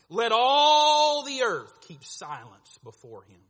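loud, very slow proclamation; proclaiming. Retold or imitated. Preacher proclaims God's word to Habakkuk, loud and slow. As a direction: thunder out the verse.

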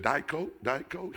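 lightly voiced waitress, high questioning lilt; waitress asking. Acting out waitress offering Diet Coke.

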